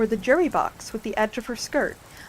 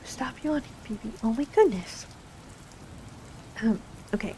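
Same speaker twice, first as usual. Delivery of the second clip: ordinary delivery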